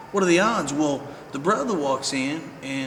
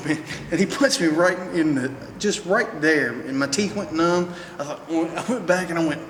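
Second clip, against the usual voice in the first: normal talking voice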